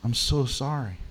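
soft, hushed, contrite; quiet sorry prayer; tearful apology. Retold or imitated. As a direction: whisper it near tears. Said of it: "with tears".

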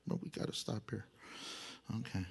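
breathy reluctant whisper; whispered aside. Real, not acted. Preacher sighing he must stop here, whispered. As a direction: whisper it to yourself.

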